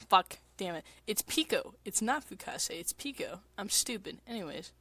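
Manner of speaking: ordinary talk, nothing marked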